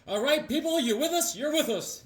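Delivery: high, coaxing leader voice; rallying hero. Acted. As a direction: win the crowd over with a high, hearty voice. Hero rallies the press.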